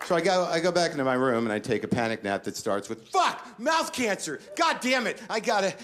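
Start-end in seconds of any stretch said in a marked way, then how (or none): 3.1-5.7 much higher, frantic hammed-up cursing; panicked outburst. Comic falling into a panic nap, frantic cursing.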